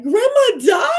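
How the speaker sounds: high, loud anguished cry of disbelief; retold crying outburst. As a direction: wail it like the news just hit. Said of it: "I just bawled crying".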